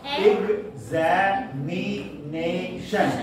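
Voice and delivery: Examination stretched out slowly, each of its five syllables drawn apart; teacher spells out examination slowly to count the syllables. Shown as a demonstration. Teacher to students, stretching examination slowly syllable by syllable. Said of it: "It has five syllables".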